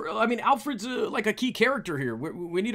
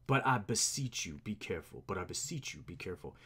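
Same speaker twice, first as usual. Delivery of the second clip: lightly voiced butler, dropping low and soft; understated soft plea; pleading butler; 11 semitones lower, 8 dB quieter than usual. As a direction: quietly implore. Alfred begs Bruce to take care.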